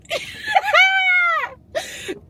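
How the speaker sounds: high joyful shriek of a laugh, near tears; laughing cry. Real, not acted. Vlogger overwhelmed by good news, shrieking laugh-cry. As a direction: burst into a happy laughing cry.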